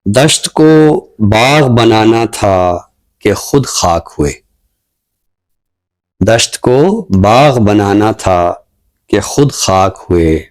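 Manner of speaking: slow, measured ghazal recital, lingering on lines; poem recitation. Acted. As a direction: recite each couplet slowly, letting it land. Poet recites his ghazal.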